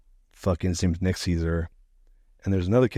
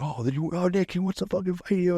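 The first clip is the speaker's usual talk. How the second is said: high, sing-song jeering bully voice; mocking taunt; 10 semitones higher than usual. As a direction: egg on the fight with a mocking high voice. Bully taunting, egging on fight.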